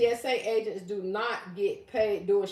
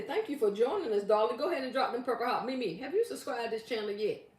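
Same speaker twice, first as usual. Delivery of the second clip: ordinary delivery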